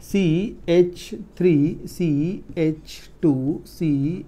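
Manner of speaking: very slow, each letter held; slow dictation. Shown as a demonstration. Teacher writing the third example, slow dictation. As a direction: spell it out at writing speed. Teacher writes a formula aloud.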